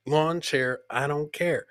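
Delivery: normal talking voice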